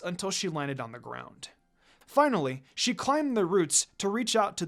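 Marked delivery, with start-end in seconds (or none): none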